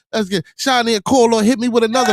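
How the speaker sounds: loud, high pleading to the chat; hype plea. Real, not acted. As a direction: streamer calling out to donors. Begging chat for donations.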